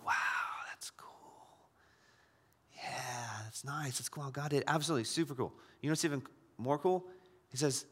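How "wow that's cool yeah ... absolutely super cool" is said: soft, half-whispered, underwhelmed; mock unimpressed. Pastor mimics a congregant's shrug, hushed and underwhelmed.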